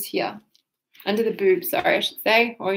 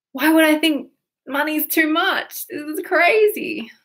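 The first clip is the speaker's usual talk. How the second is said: tapping script. Shown as a demonstration. Coach voicing a money block, rising to exasperation. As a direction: let the frustration climb.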